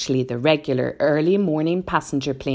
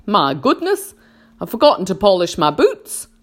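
centipede exclaiming; 3 semitones higher, 5 dB louder than usual. A narrator's reading. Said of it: "the centipede said".

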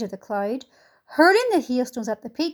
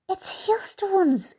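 high breathless whisper; excited whisper; 7 semitones higher, much slower than usual. A narrator's reading. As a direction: whisper it, thrilled. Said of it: "whispered James excitedly".

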